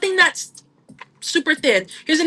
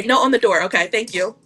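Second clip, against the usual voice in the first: normal talking voice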